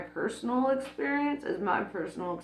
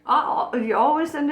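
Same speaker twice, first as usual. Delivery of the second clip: mock yelling at God; 7 semitones higher, 4 dB louder, faster than usual. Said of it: "I can yell at God and be like".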